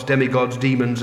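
usual speaking voice

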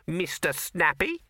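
high, slow mocking rabbit; grudging rabbit. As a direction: taunt him with the drawn-out name. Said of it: "rabbit that forgot a grudge".

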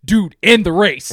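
slow, loud, fed-up demand; impatient heckle at the screen; exasperated plea. Retold or imitated. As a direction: drag it out, at the end of your patience. Retelling impatience at long scene.

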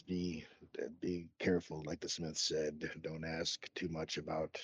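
hushed, discreet aside; very quiet warning. Acted. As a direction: lean in and warn them quietly. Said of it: "leans over and very quietly says".

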